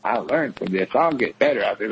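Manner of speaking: dropped low, mocking the self-punisher; low mock inner voice, dismissive; lightly voiced mockery. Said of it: "you're like".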